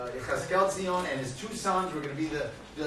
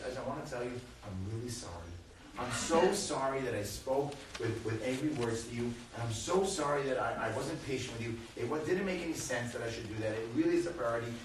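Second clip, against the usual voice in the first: soft, lowered voice, tender and earnest; sincere apology; 4 semitones lower, 5 dB quieter than usual. Retold or imitated. Father saying sorry to his son for angry words, soft and sincere. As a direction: re-enact a gentle apology to a child.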